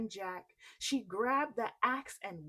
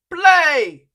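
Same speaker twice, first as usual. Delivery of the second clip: huge loud far-off roar; roaring command; 6 semitones higher, 23 dB louder than usual. Acted. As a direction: bellow it at the harp. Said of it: "he roared".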